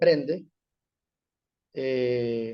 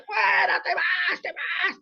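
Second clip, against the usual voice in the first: put-on much higher cry-shout at the possessed girl; frantic yelling; 13 semitones higher, slower than usual. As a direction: shriek the command like a panicked helper. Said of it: "diciéndole". (grito agudo y desesperado)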